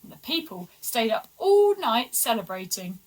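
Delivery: usual speaking voice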